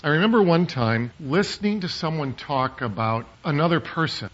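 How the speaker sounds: ordinary delivery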